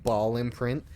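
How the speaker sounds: usual speaking voice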